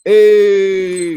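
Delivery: high, loud drawn-out holler; mock greeting call. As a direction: imitate calling back to a fan. Player answers the friends' call.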